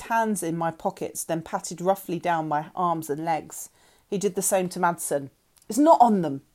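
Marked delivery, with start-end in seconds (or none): 5.7-6.4 loud call upward; yell. A narrator's reading. Frank calls up the steps to Sonia, loud yell. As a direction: shout it up the steps, much louder. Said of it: "he yelled up to Sonia".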